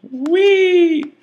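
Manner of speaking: a high, gleeful "whee"; high squeal. Real, not acted. Gamer squealing as he drags the elephant.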